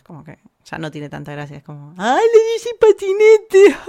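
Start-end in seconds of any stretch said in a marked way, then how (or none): 2.0-3.8 very high, loud, slow squeal; mock excitement. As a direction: shriek it in exaggerated silly delight. Mocks overexcited reaction to Spanish word. (chillido agudo, burlón y exagerado)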